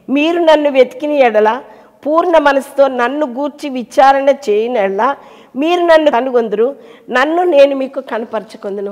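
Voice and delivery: loud, high proclaiming of the verse; preaching scripture. Real, not acted. Quoting scripture with force. (వచనాన్ని బిగ్గరగా ప్రకటించడం)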